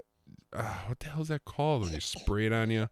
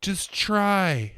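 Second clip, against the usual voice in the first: mock wife's urging, high and rising in force; high-pitched retold nagging; exasperated urging; 9 semitones higher, 4 dB louder than usual. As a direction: imitate a wife pushing him to try. Wife urging him in retold quarrel.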